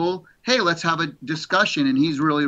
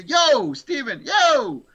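excited mock shout; 10 semitones higher, much slower than usual. Rock star friend calling him on the phone, mock shout. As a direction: call out his name like an excited old friend.

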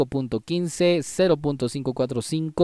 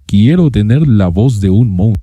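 lowered, pitch-shifted monster voice; monster voice effect; 6 semitones lower, 13 dB louder than usual. Shown as a demonstration. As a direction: voice pushed down like a monster. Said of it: "el efecto de la voz de monstruo".

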